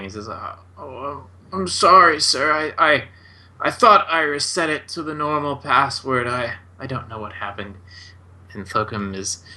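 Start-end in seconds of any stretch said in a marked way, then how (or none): 0.3-7.8 higher-pitched, faltering wizard; stuttering apology. Acted. Bedraggled wizard apologizing to his superior, stuttering. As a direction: stammer an apology as the defeated old wizard. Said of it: "mumbling and sort of stuttering".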